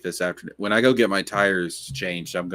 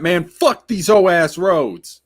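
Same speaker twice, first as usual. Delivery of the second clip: Eric impression; 8 semitones higher, 5 dB louder, slower than usual. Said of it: "to do Eric".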